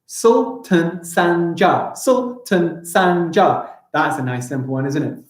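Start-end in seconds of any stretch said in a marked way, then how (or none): 0.1-2.0 very slow "Sultan Sanjar" in four beats; even, separated beats on "Sultan Sanjar"; syllable by syllable. Shown as a demonstration. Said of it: "Four syllables". 2.0-3.7 very slow "Sultan Sanjar" in four beats; even, separated beats on "Sultan Sanjar"; syllable by syllable. Shown as a demonstration. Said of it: "Four syllables".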